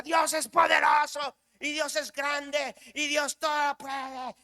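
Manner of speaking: high, slow, drawn-out shouts; re-enacted full-force shouts of faith; shouted praise. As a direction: shout it out like a jumping new believer. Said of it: "gritaba y, y decía".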